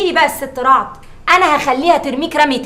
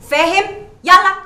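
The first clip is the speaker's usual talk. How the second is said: louder, higher bossy hurry; impatient order; 5 semitones higher, 6 dB louder than usual. Acted. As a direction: rush him like a spoiled madam. Madam hurrying servant. (أمر بنفاد صبر)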